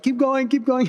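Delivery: very high-pitched egging on; mock urging. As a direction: imitate a friend pushing you on, squeaky high. Acts out Jan urging him on.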